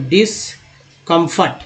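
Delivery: डिसकम्फर्ट slowly, in separate parts; broken into parts. Shown as a demonstration. Teacher showing learners how parts join, डिसकम्फर्ट slow and split. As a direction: split डिसकम्फर्ट after "dis", drawn out. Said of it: "तोड़ तोड़ के कैसे पढ़ेंगे".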